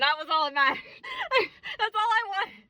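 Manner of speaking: high squealing laugh through words; bubbling over with joy; gleeful laugh. Real, not acted. Vlogger squealing with glee about the journalism conference.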